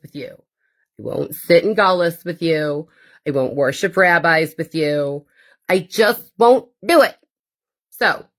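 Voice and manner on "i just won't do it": defiant. Real, not acted. Defiant refusal to the community.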